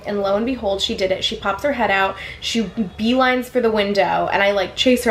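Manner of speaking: ordinary talk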